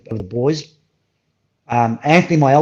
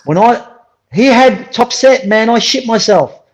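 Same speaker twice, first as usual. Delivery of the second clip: excited; 9 semitones higher, 6 dB louder, slower than usual. Real, not acted. Excited retelling of shock.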